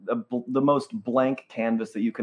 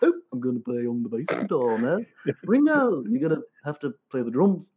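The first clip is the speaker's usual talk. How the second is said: [name] impression; 2 semitones higher than usual. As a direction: play a chirpy Beatle bossing the band around. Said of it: "my [name] impression".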